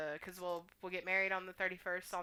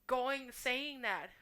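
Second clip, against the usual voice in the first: ordinary delivery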